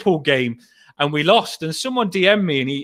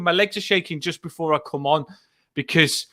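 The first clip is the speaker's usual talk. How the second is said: ordinary talk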